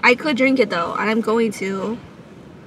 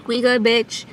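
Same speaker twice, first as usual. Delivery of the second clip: ordinary talk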